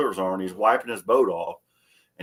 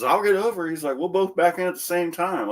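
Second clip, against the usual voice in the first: lightly mocking old man; 8 semitones higher than usual. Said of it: "he says".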